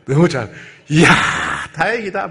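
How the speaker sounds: acted delight at a lucky escape; overjoyed relief. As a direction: exclaim as if hugely relieved. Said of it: "너무 좋았겠죠".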